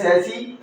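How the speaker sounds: सैसी said "SAS-ee", silent final s; correct pronunciation. Shown as a demonstration. Teacher giving students the correct word, pronounced "sas-ee". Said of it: "pronunciation की बात करें".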